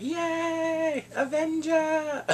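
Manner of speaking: put-on high, drawn-out child cheer; cheering little kids. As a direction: imitate small children hailing a hero. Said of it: "little kids like".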